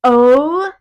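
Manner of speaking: loud, drawn-out shocked reaction; creeped-out reaction. Real, not acted. Hearing the phone answered by itself, creeped-out. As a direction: let the astonishment swell loudly.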